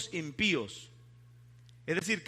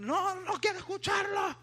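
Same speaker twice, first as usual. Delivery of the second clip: high-pitched shouted refusal, acted out; aggressive; 10 semitones higher than usual. Atheist refusing the brother who was evangelizing, imitated aggressively. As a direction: imitate a shrieked refusal to listen. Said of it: "Se puso agresiva".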